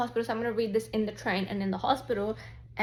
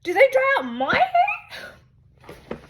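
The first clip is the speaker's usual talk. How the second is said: much higher, slow, laughing; hammed-up horrified boyfriend voice; mock horror; 7 dB louder, much slower than usual. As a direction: gasp it out in comic horror. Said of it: "in horror and goes".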